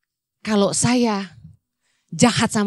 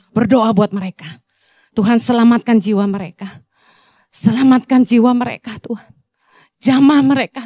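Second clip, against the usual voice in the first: earnest, slightly louder prayer leading; 2 semitones higher, 5 dB louder than usual. Acted. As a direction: guide the church into prayer. Leads congregation in prayer.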